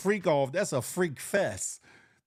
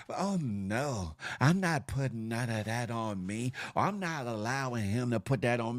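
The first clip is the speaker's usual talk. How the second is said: mock prudish voice; 7 semitones lower, 4 dB quieter than usual. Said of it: "Some of y'all wanna act prudish".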